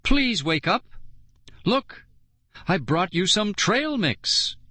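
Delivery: high pleading cry; desperate high plea; begging. A narrator's reading. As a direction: beg him to wake up. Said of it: "I begged".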